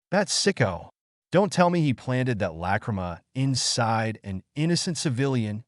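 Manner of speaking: plain reading voice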